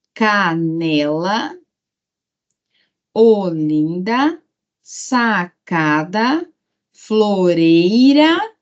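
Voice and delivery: each word drawn out slowly, syllable by syllable; slow, deliberate classroom reading. Shown as a demonstration. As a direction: read the list slowly for pupils to count syllables. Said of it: "teacher reads word list".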